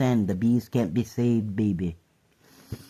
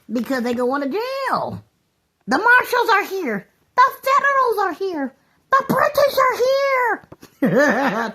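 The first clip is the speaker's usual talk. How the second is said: high excited mock alarm; 5 dB louder than usual. Mock announcement of arrest.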